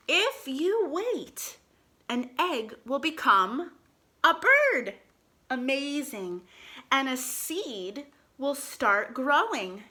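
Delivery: slow storybook reading aloud; reading to children. Acted. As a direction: read it slowly to little kids.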